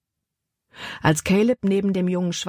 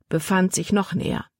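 ordinary delivery

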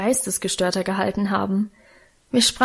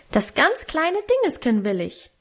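put-on high, pressing little boy's demand; insistent child; 6 semitones higher than usual. A narrator's reading. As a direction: push for it like a stubborn toddler, pitched up. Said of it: "drängte Hermann noch lauter". (drängendes, hohes Kinderstimmchen)

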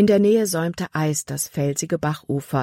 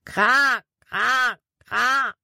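high, evenly spaced crow caws; crow call imitation; 8 semitones higher, much slower than usual. A narrator's reading. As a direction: call out like a crow, pitched well up. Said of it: "Erneut schrie Jordan". (hoch nachgeahmtes Krähenkrächzen)

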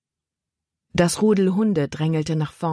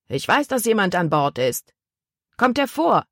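insistent hail, pitch raised on the calls; calling out; 5 semitones higher than usual. A narrator's reading. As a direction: call them out of hiding. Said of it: "rief der Mann noch einmal". (fordernd gerufen)

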